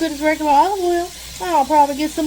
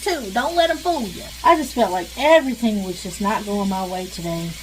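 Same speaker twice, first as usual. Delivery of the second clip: usual speaking voice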